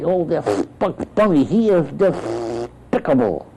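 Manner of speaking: high, spluttering, lisping duck; indignant cartoon duck with trouble speaking; Daffy Duck. Shown as a demonstration. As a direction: struggle slowly through the words with sprayed lisp. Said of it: "he'd have trouble speaking".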